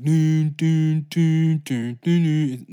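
loud mocking hum of a theme tune. Mocking the Star Wars music with loud dun dun. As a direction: belt the fanfare derisively. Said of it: "most childish bullshit ever".

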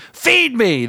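high, hungry demanding cry; beckoning voice. A narrator's reading. Voice in the trash can to the man, beckoning cry. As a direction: demand it from down in the pit. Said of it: "the voice beckoned".

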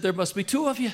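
high, lightly voiced mother with a chuckle in it; amused old mother. Mother laughing off her confusion to her son, high and amused. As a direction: play her as tickled and cheerful. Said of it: "she laughed and said".

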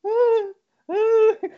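gritinho agudo de susto; susto imitado. Retold or imitated. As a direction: solta um ah assustado bem agudo. Susto com a polícia. (imitando o próprio susto, bem agudo)

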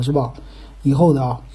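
usual speaking voice

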